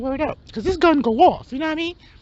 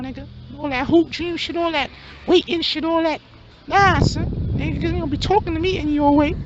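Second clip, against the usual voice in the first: hustler friend; 2 semitones higher than usual. Acted. High-voiced hustler rants.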